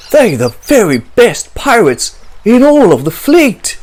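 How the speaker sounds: a man passing on harbour gossip, excited; a man's news. Acted. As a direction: announce the battle like an eager sailor. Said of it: "she heard a man say".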